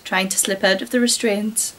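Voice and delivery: usual speaking voice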